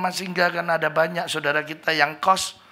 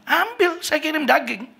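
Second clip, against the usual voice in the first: high, called-out divine command; heavenly voice; 10 semitones higher than usual. Retold or imitated. Mysterious voice telling the preacher, high and called out. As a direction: voice from above giving an order. Seperti ada orang ngomong.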